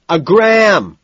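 high, loud order; hollered over the crowd; shout. A narrator's reading. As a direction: yell the order to the dealer. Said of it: "Price shouts to Ted".

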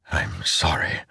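soft sorry whisper. Acted. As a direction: whisper it, grieving. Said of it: "he whispered".

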